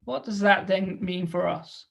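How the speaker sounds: ordinary delivery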